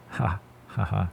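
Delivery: stifled laugh through a blocked nose; restrained laugh. Real, not acted. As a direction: hold back a laugh carefully. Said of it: "I can't laugh because of the nose thing".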